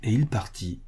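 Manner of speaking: ordinary delivery, nothing marked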